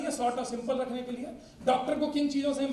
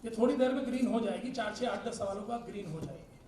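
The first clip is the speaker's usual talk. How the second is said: ordinary delivery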